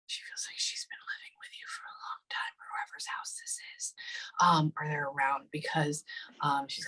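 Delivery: hushed relayed message; whisper. Real, not acted. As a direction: whisper the message as if passing on a secret. Relaying what the spirit says.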